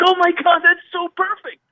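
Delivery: high, thrilled exclamation, half sung out; light up at the perfect idea; excited delight. Retold or imitated. Hearing the gospel outro idea, he answers the drummer with high thrilled delight.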